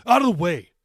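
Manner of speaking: loud snarling order; angry teen snarling at grandmother; snarled. A narrator's reading. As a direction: shove the words at her. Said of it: "he snarled".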